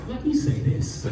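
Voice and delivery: slow breathy laughing evasion; coy dodge. Retold or imitated. Answering his date's size question, coy and laughing. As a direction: tease her by dodging. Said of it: "I said".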